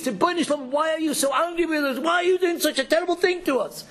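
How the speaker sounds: high, pleading cry upward; anguished plea to God. Acted.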